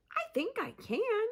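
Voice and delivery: high gull voice; chirpy gull voice. Acted. Gull answering Pip in a high voice. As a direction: chirp it like a seabird.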